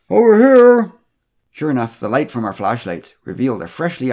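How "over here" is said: loud, high muffled call. A narrator's reading. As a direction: call out from under a tree. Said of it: "answered in a muffled voice".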